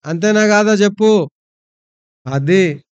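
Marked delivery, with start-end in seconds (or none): 0.0-1.3 say it louder. Shown as a demonstration. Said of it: "అట్లా గట్టిగా చెప్పాలి".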